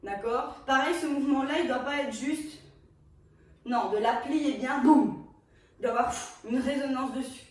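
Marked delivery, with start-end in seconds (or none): 4.8-5.2 loud beat hit. Shown as a demonstration. Teacher voicing a hit.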